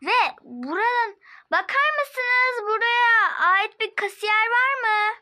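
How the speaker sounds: calling out. Acted. Calling out for a cashier.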